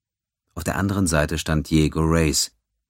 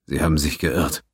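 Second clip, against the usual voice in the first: toneless. A narrator's reading. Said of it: "erwiderte Reyes tonlos".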